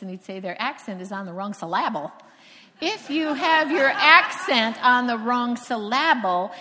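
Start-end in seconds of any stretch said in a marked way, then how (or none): 0.4-2.1 "syllable" stressed wrongly on its second part, sil-LAB-ul; teasing mispronunciation. Retold or imitated. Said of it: "Richard used to tease me". 2.8-6.5 "syllable" drawn out as sil-LAB-ul; slow and loud, stress the LAB in "syllable"; misplaced stress. Shown as a demonstration. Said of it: "putting the emphasis on the wrong thing".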